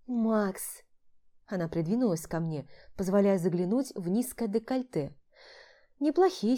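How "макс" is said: cooing woman. Acted. Vlada cooing his name.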